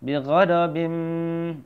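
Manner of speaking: "ghadabim" chanted slowly with a long hummed m; merged bim. Shown as a demonstration. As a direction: hold the m closing "ghadabim". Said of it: "masukkan binnya menjadi".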